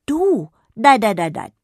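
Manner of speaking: loud, high emphatic no; firm refusal. Acted. Fairy refuses Jonna's offer, loud firm no. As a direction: shut the idea down hard.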